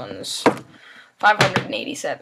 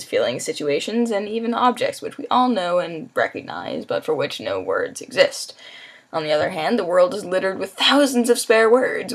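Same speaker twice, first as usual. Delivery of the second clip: clear, louder book reading; reading aloud; 2 semitones higher, 5 dB louder than usual. Acted. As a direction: read it out like a storyteller. Reads book passage aloud.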